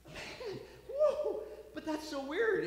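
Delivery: high, laughing whoa of disbelief; stunned dad. Acted. Second dad hearing he has triplets, high laughing surprise. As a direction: jump up high in amazed disbelief.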